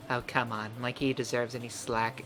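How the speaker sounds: put-on soft, snippy girl voice; Natsuki voice. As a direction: scoff as the tsundere. Voicing Natsuki.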